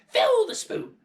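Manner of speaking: put-on high, loud cranky old-woman orders; bossy grandmother. A narrator's reading. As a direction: bark orders like a grumpy old hag. Grandmother commands.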